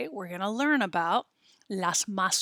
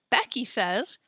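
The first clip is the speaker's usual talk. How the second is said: normal talking voice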